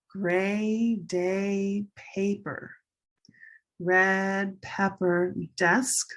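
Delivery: slow, lengthened vowels; pronunciation drill. Shown as a demonstration. Teacher models vowel key words for learners, slow drill. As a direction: model each word slowly and separately. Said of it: "color vowel really helps you be understood".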